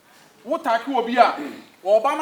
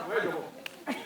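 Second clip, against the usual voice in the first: usual speaking voice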